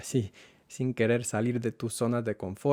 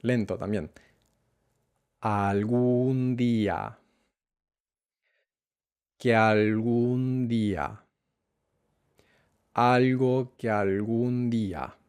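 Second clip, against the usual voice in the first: slow backward build-up; slower than usual. Shown as a demonstration. Teacher building the phrase backwards with the student, slowly. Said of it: "empezando con la última palabra".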